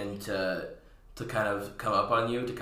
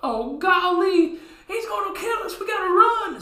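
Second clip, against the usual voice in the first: much higher, louder cartoon panic; panicked clown; 6 dB louder than usual. Clowns terrified the cowboy will kill them, high shrieking panic. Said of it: "clowns flee in panic".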